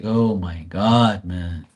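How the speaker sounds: low, slow fed-up groan; exasperated. Real, not acted. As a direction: sigh it out, worn down. His own exasperated reply.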